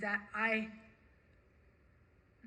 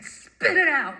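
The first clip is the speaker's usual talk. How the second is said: loud, higher, impatient retort; sharp, defiant demand at raised pitch; defiant to police; 7 semitones higher, 11 dB louder, slower than usual. Retold or imitated. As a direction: throw it at the cop, loud and challenging. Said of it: "that's how I talk to police".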